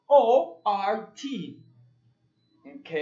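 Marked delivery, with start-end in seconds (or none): 0.1-1.6 loud, slow letters; loud slow spelling. Shown as a demonstration. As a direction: call the letters out slowly. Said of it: "tenemos las letras". (deletreo fuerte y lento)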